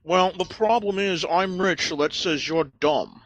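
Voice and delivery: high, smug rich snob. Acted. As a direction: sneer like a spoiled aristocrat. Snob character defends wealth.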